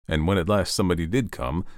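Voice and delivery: usual speaking voice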